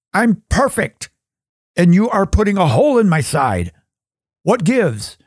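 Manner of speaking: high, affronted protest; indignant stone. Acted. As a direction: complain like a vain stone.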